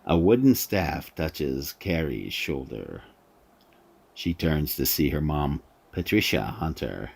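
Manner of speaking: usual speaking voice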